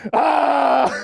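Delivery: high, loud hammed-up yell; mock battle yell. Charging the door at imagined porch thieves, mock yell. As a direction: scream like you're charging an intruder.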